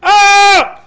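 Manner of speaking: very high, loud scream. Acted. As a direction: shriek at the last meter. Japanese jumper screams at the end.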